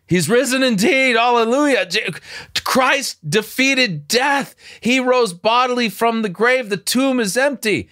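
high, ringing declaration of the resurrection; rising joyful church shout of faith; joyful proclamation. Real, not acted. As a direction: proclaim it like good news from a pulpit.

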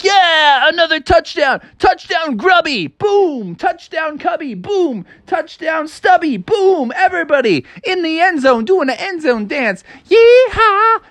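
high, gloating cheer; wolf voice. Acted. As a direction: cheer like a cocky team scoring touchdowns. Wolves cheering after scoring.

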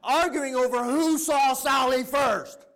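high, drawn-out incredulous yell; exasperated shout. Real, not acted. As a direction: cry it out at full volume, stretching the words. Preacher exasperated at children's petty squabble.

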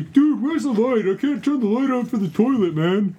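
high, mock-panicked whine; whining guest imitation. Co-host mimicking a guest lost in his house, high whine.

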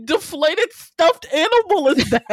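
high, loud, drawn out through laughter; real incredulous laughing. Real, not acted. Viewer mocking the prop cat's body, laughing in disbelief. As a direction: crack up while asking in disbelief.

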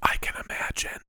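hushed whisper. Real, not acted. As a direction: answer in a whisper. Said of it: "Why are we whispering?".

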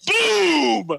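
high, loud mock battle yell; hammed-up shout of boom. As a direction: bark it out like a badass. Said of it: "all that came out was me going".

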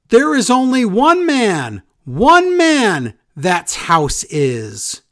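high, loud wrestler's yell with long drawn-out words; Miz yelling. Retold or imitated. Podcaster re-enacts a wrestler's go-home promo, mock yelling. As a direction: bellow it in mock wrestling-promo style, stretching the key words. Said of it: "Miz keeps yelling".

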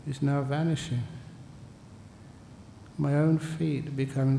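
soft, calm meditation voice; guided meditation. Acted. Teacher guiding listeners through a body scan, soft and calm. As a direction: guide them gently through the feet.

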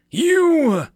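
loud, high accusing shout; crying out. Acted. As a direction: cry it out, high and drawn out. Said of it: "a man cried out".

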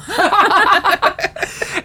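long high laugh; real helpless laughter. Real, not acted. Laughing at Sylvia's line.

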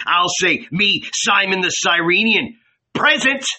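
high, heated rebuke turning into bold resolve; indignant innkeeper. A narrator's reading. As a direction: scold the cowards, then announce yourself proudly. Simon rebukes disciples, volunteers.

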